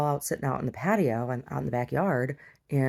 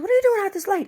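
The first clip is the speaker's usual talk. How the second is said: much higher and louder, fussing; mother the stranger on the corner; neighbor voice; 10 dB louder, faster than usual. Retold or imitated. Neighbor voiced, high put-on.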